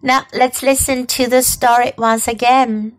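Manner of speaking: ordinary delivery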